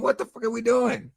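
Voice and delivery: high-pitched, exasperated disbelief; mock outrage at the stream; half-mocking high what-the-hell. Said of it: "I'm like".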